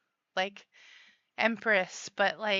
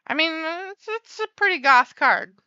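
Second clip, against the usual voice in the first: playful emphatic; 7 semitones higher, 10 dB louder than usual. Real, not acted. Playful emphatic remark on card.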